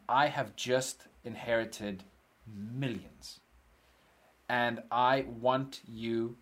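slow, deliberate mock announcement; acted announcement. Homeless customer announcing riches to the shop worker, slow and deliberate. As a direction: space the words out with great import. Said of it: "And he says to me".

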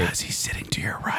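soft unvoiced stage whisper. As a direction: whisper it like a secret aside. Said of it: "Why are you whispering?".

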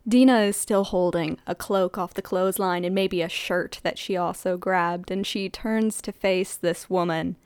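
normal talking voice

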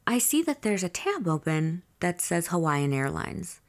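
usual speaking voice

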